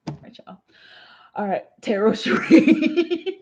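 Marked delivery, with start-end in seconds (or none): 0.1-0.6 unvoiced whispered goodbye; whispering. Real, not acted. Whispered sign-off.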